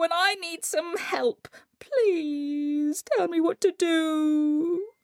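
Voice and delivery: high, effusive, pleading Coyote; wheedling Coyote. A narrator's reading. Coyote begging his three sisters for help, wheedling.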